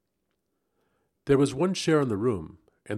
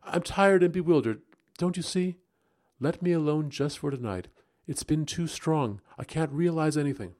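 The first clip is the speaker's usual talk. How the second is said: higher, pleading and impatient; impatiently; 6 semitones higher, 3 dB louder than usual. A narrator's reading. Rebecca begging to be left alone tonight, impatient. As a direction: push him away, tired and rushed. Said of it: "she said impatiently".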